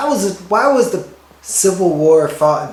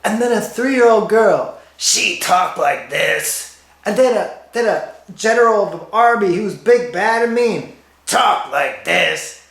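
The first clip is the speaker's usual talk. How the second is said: higher storytelling lead-ins dropping into long, drawn-out punchlines; bad storyteller impersonation; 5 semitones higher, slower than usual. Comic quoting a man telling a story, badly done impersonation. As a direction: impersonate a storyteller, stretching the end of each character line. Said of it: "I used to be able to do that impersonation properly".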